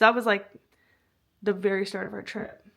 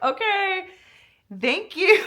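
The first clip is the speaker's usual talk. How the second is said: laughing okay; 10 semitones higher, 9 dB louder, slower than usual. Retold or imitated. Baffled reply while laughing.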